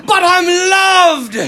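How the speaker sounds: high, loud, drawn-out Irish cry; Irish accent. Retold or imitated. Imitating an Irish priest calling back to students, exultant Irish accent. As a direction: proclaim it slowly in a hammed-up Irish accent. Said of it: "this deep Irish accent".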